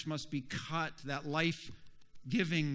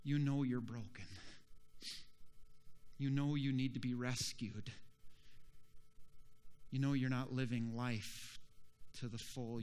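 low, soft, spaced-out phrases; gentle Spirit of God; 4 semitones lower, 7 dB quieter than usual. Acted. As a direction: speak softly, like a breeze. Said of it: "the Spirit of God blows".

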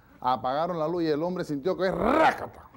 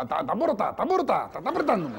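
high, loud, frantic hammed-up alarm; mock panic; 6 semitones higher, 5 dB louder than usual. Guest panicking in the dark bed, hammed-up high alarm. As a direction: squeal it in mock terror.

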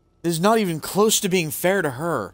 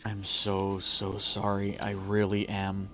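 soft, slow, ashamed apology; tearful shame; 5 semitones lower, 7 dB quieter, slower than usual. Real, not acted. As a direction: say sorry quietly, drawing it out. Quiet, slow apology to the character.

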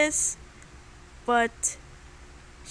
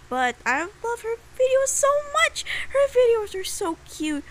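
higher, louder, gushing over her videos; 10 semitones higher, 7 dB louder than usual. Real, not acted. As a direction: gush with delight. Gushing about friend's videos.

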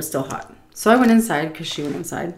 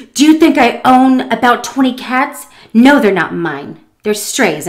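louder, indignant retort; mock indignation; 6 semitones higher, 9 dB louder than usual. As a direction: answer a silly question as if offended. Woman's indignant answer, acted.

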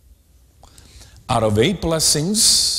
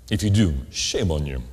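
normal talking voice

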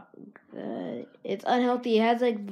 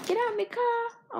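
bossy car owner; 13 semitones higher than usual. Pretend. Playful car owner act.

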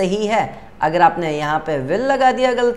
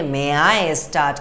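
slow polite model sentence; 3 semitones lower, much slower than usual. Shown as a demonstration. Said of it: "teacher से आप बोलेंगे".

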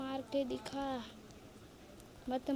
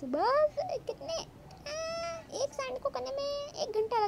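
very high, whiny exaggerated gripe; high-pitched pretend wail; mock-wailing complaint; 12 semitones higher than usual. Exasperated complaint about slow digging.

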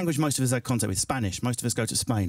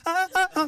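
high-pitched repeated honking; horn honks. Shown as a demonstration. Comic shows Brits speaking by horn, high honks. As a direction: honk like a horn in place of speech. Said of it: "We'd speak with a horn".

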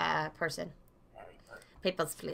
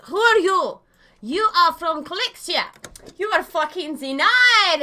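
loud, high hammed-up official; mock bossy inspector; 6 semitones higher, 6 dB louder than usual. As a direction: play at interrogating and rejecting a traveller. Playing a bossy border inspector.

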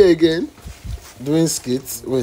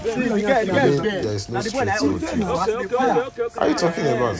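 much higher, scared whining; frightened high voice; 11 semitones higher than usual. Acted. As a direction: squeak it in fear.

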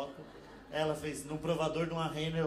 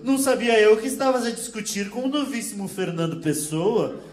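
loud, laughing Portuguese put-down; Portuguese accent, loud and laughing; mock Portuguese accent; 4 semitones higher, 9 dB louder than usual. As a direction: crack up through a loud Portuguese jab.

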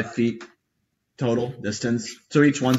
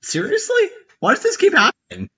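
exasperated disbelief. Real, not acted. DM exasperated at absent player.

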